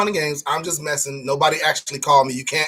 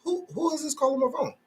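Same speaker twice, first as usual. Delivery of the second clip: puzzled caller; 8 semitones higher, 5 dB quieter than usual. Retold or imitated. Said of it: "I was like".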